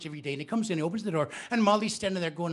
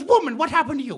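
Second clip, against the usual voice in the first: higher, louder alarmed cry; shocked husband; 8 semitones higher, 10 dB louder than usual. Acted. Husband shocked at his wife's torn dress, crying out. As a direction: gasp it out at the sight of her.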